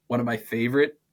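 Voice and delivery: ordinary delivery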